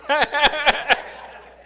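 high laugh. Real, not acted. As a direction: laugh out at the story. Laughs at story.

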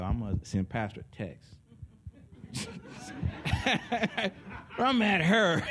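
ordinary delivery

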